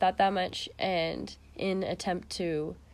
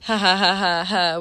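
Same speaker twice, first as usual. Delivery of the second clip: loud spelled-out ha ha; hammed-up prank-caller laugh; mock creepy laugh; 8 dB louder than usual.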